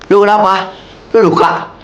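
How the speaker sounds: irritated concern. Acted. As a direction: scold her while checking the wound. Steph reacts to Karina's injury. (khawatir tapi nadanya memarahi)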